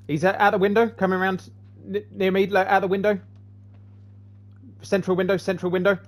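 urgent callout. Real, not acted. Calling enemy position in fight.

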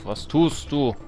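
ordinary delivery

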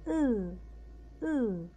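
"أو" held as a long French eu; "أو" alone, stretched; lengthened vowel. Shown as a demonstration. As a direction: hold "أو" out, lips rounded. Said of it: "نطقنا أو بس مديناها".

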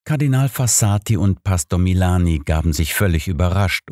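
ordinary delivery

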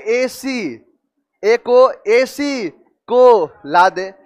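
"एसी... को... ला दे" very slow, in separate chunks; broken-up mnemonic. Shown as a demonstration. Teacher breaking a word into a memory phrase, slow and split. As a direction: spell out the sound bite by bite, pausing between pieces.

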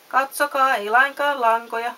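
storybook cry of showing off; excited shout. A narrator's reading. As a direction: call out proudly to the crowd. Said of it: "hän huusi".

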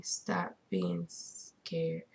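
very slow unvoiced whisper; creepy whispered quote. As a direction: breathe it slowly in a whisper. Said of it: "reading his message".

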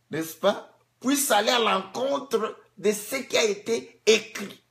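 high, loud preaching at full force; fiery preaching. Acted. Preacher stirring the congregation, at full force.

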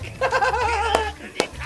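high, shouting burst of laughter; excited laugh. Real, not acted. Laughing over returning character.

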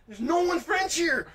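high, loud, drawn-out comic outburst; mock yelled retort. Storyteller firing back at the French Canadian, mock yelled retort. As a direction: yell it back in mock outrage.

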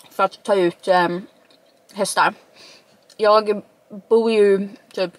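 speaking around food in mouth; talking between bites; chewing while talking. Real, not acted. Seen 1: Took a bite just before; jaw and lips move as if chewing.

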